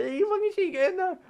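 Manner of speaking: very high mock herding yell; yelling at sheep. Farmers chasing sheep in four by four, high-pitched mock yell.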